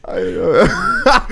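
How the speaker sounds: words squeezed out through laughter; can barely talk for laughing; laughing hard. Real, not acted. Laughing fit while telling story.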